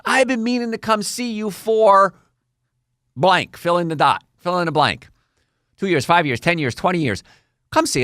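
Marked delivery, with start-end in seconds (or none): 0.1-3.6 higher, slow, drawn-out excuse; procrastinating patient. Acted. A patient making excuses to the doctor, slow higher mock whine. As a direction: stretch it out like a lame excuse, pitched up. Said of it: "patients that says".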